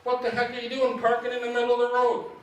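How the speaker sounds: high, loud indignant demand; a stranger's loud scolding of the parked driver; angry retold yell. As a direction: bark it like an offended stranger. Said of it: "The guy comes up to me and he goes".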